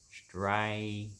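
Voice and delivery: ordinary delivery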